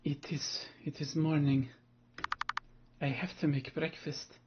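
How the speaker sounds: lightly voiced doll character; a gentle hint of a talking doll; Bloodborne doll voice. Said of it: "I try to give them that voice".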